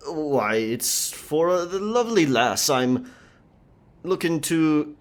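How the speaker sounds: put-on over-the-top pirate accent; hammed-up pirate speak. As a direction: play a bad stage pirate to fool the merchant. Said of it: "the pirate speak".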